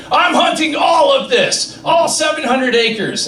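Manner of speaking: mock yuppie boast. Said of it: "they tell me".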